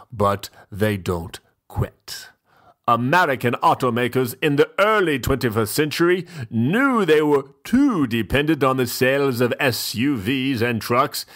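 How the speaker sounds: usual speaking voice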